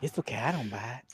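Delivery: put-on old pervert voice. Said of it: "the old guy from fucking Family Guy".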